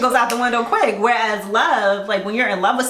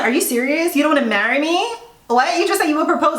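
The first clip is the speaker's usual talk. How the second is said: higher, incredulous questioning; retold disbelief; 7 semitones higher than usual. Woman confronting the man who meant to propose, mock incredulous. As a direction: imitate her shock at being turned down.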